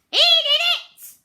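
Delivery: very high, very loud squawk; squawking parrot. A narrator's reading. Silly parrot blaming Pete, shrill squawk. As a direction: screech it like a tattling bird.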